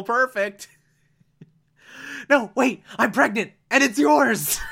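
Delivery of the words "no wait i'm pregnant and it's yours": high, frantic pleading as Janet; begging for my life. Retold or imitated. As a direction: plead for your life, voice shooting up. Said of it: "every time she does the whole beg for her life thing".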